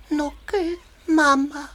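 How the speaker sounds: ordinary talk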